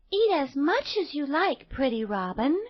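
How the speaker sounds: put-on high, sweet little girl asking kindly. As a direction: play a gentle young girl.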